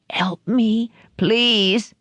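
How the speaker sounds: high, pleading dying moan; a hint of a dying man's moan; moaning plea. A narrator's reading. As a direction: beg weakly through pain. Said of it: "DeCarlo moaned".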